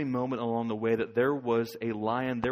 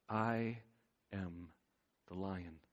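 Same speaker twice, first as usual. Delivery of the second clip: solemn hush; 3 semitones lower, 7 dB quieter, slower than usual. Acted. God's voice, solemn declaration.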